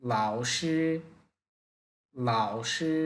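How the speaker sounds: লাও dipping low, শি held level; লাও broken low, শি drawn out flat; Mandarin tones. Shown as a demonstration. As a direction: break লাও, then stretch শি. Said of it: "প্রথম পার্টটা একটু ভেঙে বলতে হবে".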